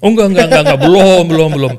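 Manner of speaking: loud, hurried no no no, laughing it off; loud emphatic denial. Real, not acted. To the host asking about jumping, a loud emphatic denial. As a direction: shout the denial to brush off the joke.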